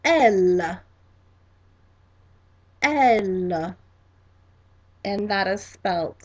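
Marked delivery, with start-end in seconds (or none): 0.0-0.8 "elle" said clearly as French, with a drawn-out l; French pronunciation. Shown as a demonstration. Teacher showing how French she is pronounced, clear French pronunciation. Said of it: "is pronounced". 2.8-3.8 "elle" said clearly as French, with a drawn-out l; French pronunciation. Shown as a demonstration. Teacher showing how French she is pronounced, clear French pronunciation. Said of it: "is pronounced".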